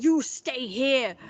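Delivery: emphatic retold "don't leave me" tone; retold urgent order. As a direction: stretch out each word as a pleading order.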